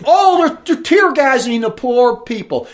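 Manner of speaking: high, loud mock-pitying voice; mimicking critics' fake sympathy; mocking whine. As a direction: sneer at the pity. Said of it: "And they're saying".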